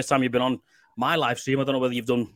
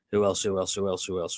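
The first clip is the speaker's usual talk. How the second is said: fading mutter; 6 semitones lower, 6 dB quieter than usual. Real, not acted. Host muttering while scanning chat.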